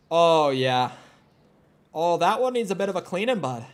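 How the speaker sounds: hammed-up Canadian buddy accent; exaggerated Canadian accent. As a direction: lay the Canadian accent on thick. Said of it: "a really strong Canadian accent".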